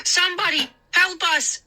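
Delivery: high, desperate shout; frantic call. A narrator's reading. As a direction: call for help while running. Moose calling for help.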